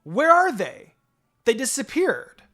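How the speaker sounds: loud, high panicked calls; frantic. Acted. The group of girls frantically searching the hall. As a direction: shout it out in confusion.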